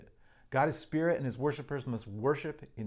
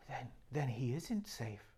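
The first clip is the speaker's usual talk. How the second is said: put-on much softer, slow, awed little girl; Lucy; 9 dB quieter, slower than usual. Lucy realising Aslan isn't safe, soft and slow. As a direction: breathe the realisation quietly. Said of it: "said Lucy".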